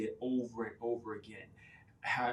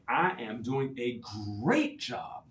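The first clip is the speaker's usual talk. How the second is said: emphatic affirmation; 4 dB louder, slower than usual. Shown as a demonstration. Coach showing caregivers a strong affirmation, emphatic. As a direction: push the words up with conviction. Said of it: "hey, turn that thing around".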